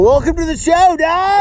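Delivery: high-pitched shouted show welcome; hyped-up welcome. Pretend. Host welcomes guest back to show, hyped-up shout. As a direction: holler it like a game-show host.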